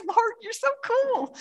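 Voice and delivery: high, slow, delighted cry to the Lord; amazed prayer. Retold or imitated. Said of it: "I'm like".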